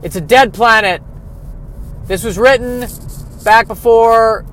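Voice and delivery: yelling the obvious at the screen; ranting to himself. Retold or imitated. Watching the movie, he rants at it in loud disbelief. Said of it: "I'm sitting there watching it thinking".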